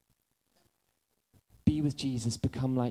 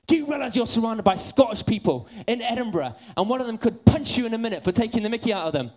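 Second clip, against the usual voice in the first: high, loud retold telling-off; mock scolding; 8 semitones higher, 7 dB louder than usual. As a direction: imitate someone urgently warning a careless companion. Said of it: "[name] jabs in the rest".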